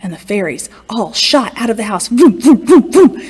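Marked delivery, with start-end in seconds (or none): 2.1-3.2 zooming vroom. A narrator's reading. Fairies zooming out sound.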